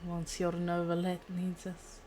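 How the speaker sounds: ordinary delivery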